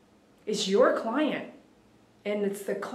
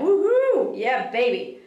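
excited whoop; 2 semitones higher, 3 dB louder, slower than usual. Retold or imitated. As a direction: read the viewer's cheer with gusto. Reading an excited viewer comment.

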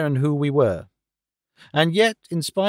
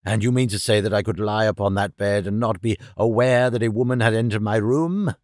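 put-on old professor, incredulous; 5 semitones lower than usual. A narrator's reading. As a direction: lower old man's voice, questioning in disbelief.

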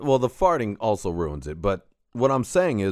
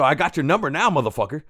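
pitched-up, heated retold threat; mock angry threat; 7 semitones higher, 4 dB louder, faster than usual. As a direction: play up the threat a little.

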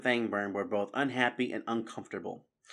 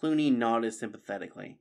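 plain reading voice

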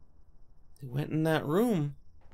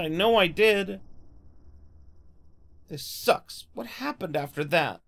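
ordinary talk

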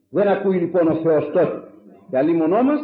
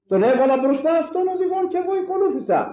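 much higher, fast impassioned cry; preaching shout; 13 semitones higher, faster than usual. Real, not acted. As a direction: build to a fervent shout. Climactic cry in sermon.

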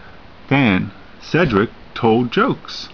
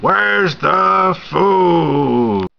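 very slow, higher, greedy wail; greedy whine; 7 semitones higher, 4 dB louder, much slower than usual. Acted. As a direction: Cutlet demanding his dinner. Greedy Cutlet demands food.

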